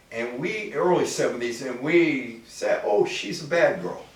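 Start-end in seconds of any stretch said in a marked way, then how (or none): none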